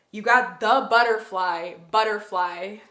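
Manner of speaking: loud thrilled "butterfly"; excited reveal. Real, not acted. Reader revealing butterfly card to viewers, loud excited. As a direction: call it out with delight.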